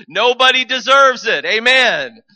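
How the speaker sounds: very loud, high declaration; preaching shout. Real, not acted. Preacher proclaiming grace to the congregation, shouting. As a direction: shout it to the back row.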